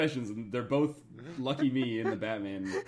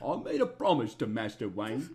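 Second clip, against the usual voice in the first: lightly voiced old butler; a hint of a Michael Caine butler; understated Alfred voice; Alfred impression; 5 semitones higher than usual. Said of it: "You sound like Deeves".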